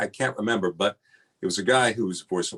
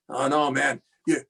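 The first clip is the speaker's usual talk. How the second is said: imitating raspy voice; 6 semitones higher, 3 dB louder than usual. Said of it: "with that raspy voice of his".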